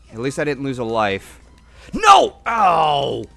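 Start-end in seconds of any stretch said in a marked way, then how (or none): 1.9-3.2 dismayed shout. Real, not acted. Player dies in game.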